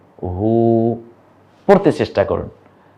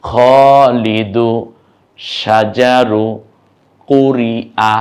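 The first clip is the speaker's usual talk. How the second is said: slow drawn-out reading; much slower than usual. Shown as a demonstration. Teacher to class, reading the new words aloud, very slow. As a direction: read every word slowly and clearly. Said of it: "এখন আরবিগুলো দেখুন".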